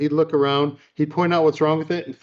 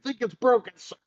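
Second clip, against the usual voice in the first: injured recruit; 10 semitones higher than usual. Retold or imitated. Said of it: "He goes".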